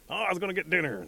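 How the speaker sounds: high, loud "oh!" of realisation; acted jolt of memory; sudden remembering. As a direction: blurt it as it comes back to you. Bear suddenly remembers dinner.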